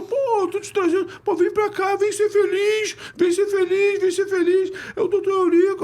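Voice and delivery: very high squeaky imitation of the coach; Jair's little voice. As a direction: mimic a high, thin voice scolding on the phone. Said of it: "com aquela vozinha dele".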